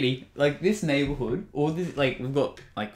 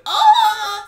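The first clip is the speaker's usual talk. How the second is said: very high, loud laughing squeal; excited recognition; 13 dB louder than usual. Retold or imitated. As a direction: re-enact a thrilled shriek. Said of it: "I was like".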